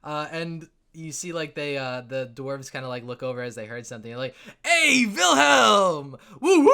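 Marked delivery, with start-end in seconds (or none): 4.6-6.7 loud, high, drawn-out party shout; rowdy greeting. Acted. Dwarves spot Wilhelm across the bar, rowdy cheering. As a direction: holler like a partying dwarf. Drunk dwarves hail a friend.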